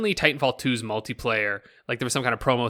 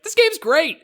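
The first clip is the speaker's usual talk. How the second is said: high and loud, all in; proudly claiming a favourite game; gushing declaration; 6 dB louder, slower than usual. Retold or imitated. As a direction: blurt it out like a devoted fan. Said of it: "I'm just like".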